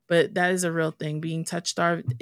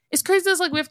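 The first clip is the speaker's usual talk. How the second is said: It's crazy. said much higher and louder, almost a yelp; excited exclaim; 12 semitones higher, 4 dB louder, much faster than usual. Real, not acted. Telling listeners about friend websites, excited loud outburst. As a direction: burst out with disbelief, pitched up high. Repeats phrase, second louder.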